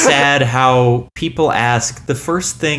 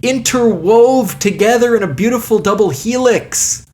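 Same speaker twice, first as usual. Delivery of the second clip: romantic storytelling; 7 semitones higher than usual. Acted. Grandiose romantic retelling of date.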